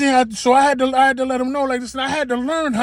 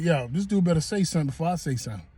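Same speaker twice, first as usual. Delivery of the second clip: muttered thought; 4 semitones lower, 6 dB quieter, faster than usual. Retold or imitated. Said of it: "I was like".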